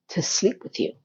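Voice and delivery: hushed, whispered come-on; mock sultry whisper. Vlogger translating Duncan as a whispered seduction. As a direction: breathe it like a sly seduction.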